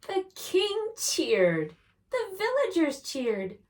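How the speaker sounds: high, loud, jubilant narration; cheering. A narrator's reading. Storyteller announcing the dragon is won over, cheering. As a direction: call it out like a cheer.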